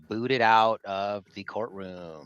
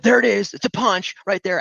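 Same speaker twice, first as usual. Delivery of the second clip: mock shout; 11 semitones higher, 8 dB louder than usual. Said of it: "they're trying to say".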